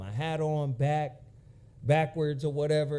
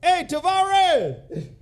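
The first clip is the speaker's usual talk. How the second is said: drawn-out call; 12 semitones higher, 10 dB louder, much slower than usual. Pretend. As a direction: stretch it out like a stage shout. Calling out the band's name.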